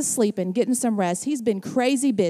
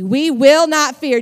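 louder, stress on will; emphatic declaration; 2 semitones higher, 8 dB louder than usual. Shown as a demonstration. Pastor repeating the verse to the church, loud emphatic. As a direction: declare it boldly. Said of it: "Did you hear that?".

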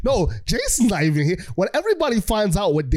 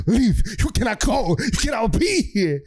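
raised, insistent plea to leave; urgent mock warning; 4 semitones higher than usual. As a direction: order him out in a higher voice. Family orders Damian to leave.

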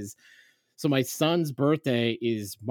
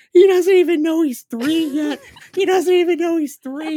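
loud, very high anguished mock cry; hammed-up wail; 11 dB louder than usual. Dad's inner cry while searching park, anguished wail. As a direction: wail it in comic despair. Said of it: "screaming his name in my head".

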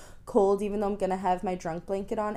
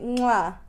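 air kiss. Real, not acted. Blowing a kiss.

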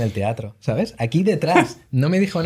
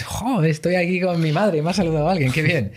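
delighted; 7 semitones higher than usual. Retold or imitated. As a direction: play the pleased, flattered self of the first phase. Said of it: "que dices". (imitando una voz encantada, más aguda)

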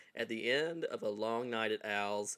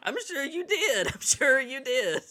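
sarcastic; 13 semitones higher, 8 dB louder than usual. Real, not acted. Teasing reply to co-host.

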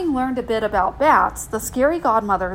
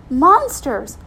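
high, loud, frightened answer; scared child; 4 semitones higher, 6 dB louder than usual. Acted. Little girl telling godmother her fear, scared. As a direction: blurt out the thing you dread. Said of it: "what were you so scared of".

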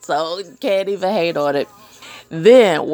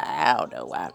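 deep, quiet repeat; 14 semitones lower, 8 dB quieter than usual. Shown as a demonstration. Repeating herself to viewers, much lower and quieter. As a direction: drop way low and soft for the echo.

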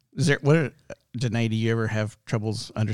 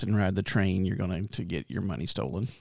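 usual speaking voice

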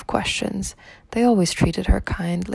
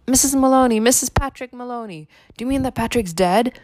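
higher, shaken answers to the police; distressed; 6 semitones higher than usual. A narrator's reading. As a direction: sound frightened on the phone. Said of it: "she cried".

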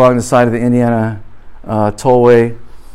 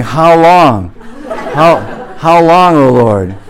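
raised, hammed-up cry of the saints; cries of the saints; 3 semitones higher, 4 dB louder, slower than usual. Preacher mimics saints crying out to the Lord. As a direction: wail it like martyrs under the altar.

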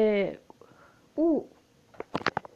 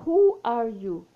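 slow "Who are you?" with a stressed Who; slow question; slow model question; 4 dB louder, slower than usual. Shown as a demonstration. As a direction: model it to a stranger. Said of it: "aí você vai falar".

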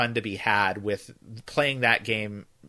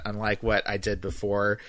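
ordinary talk

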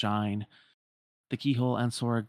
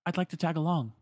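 put-on higher girlish voice, lightly eager; hopeful Shion; 6 semitones higher, 3 dB louder, faster than usual. Shion asking Roxas to take her along, light and eager. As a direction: ask to come along, a little pleading.

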